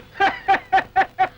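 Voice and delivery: high, staccato doctor's laugh. Acted. Doctor amused by his own tale of a ghost, laughing.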